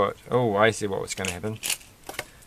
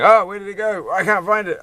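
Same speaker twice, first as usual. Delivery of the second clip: high, loud panicked whine; squeaky mock panic over a lost piece; 11 semitones higher, 7 dB louder than usual. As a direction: ham up a frantic search. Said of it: "Which is not the same thing as saying".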